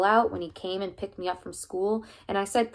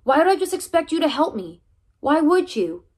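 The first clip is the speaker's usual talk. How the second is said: high, loud and hurt; boy yelling at his coach in the car; angry outburst; 9 semitones higher, 8 dB louder than usual. Acted. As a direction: throw the questions at him. Heated argument with coach.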